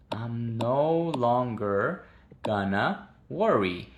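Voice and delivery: very slow, drawn-out teacher demo; slow model. Shown as a demonstration. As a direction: stretch each word for the class. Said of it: "slow model repetition".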